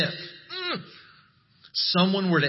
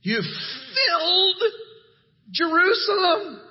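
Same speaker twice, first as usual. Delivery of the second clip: amazed exclamation; 12 semitones higher than usual. Shown as a demonstration. Preacher showing the congregation how to celebrate the charge, thrilled and high. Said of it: "don't be obnoxious about it, but, like".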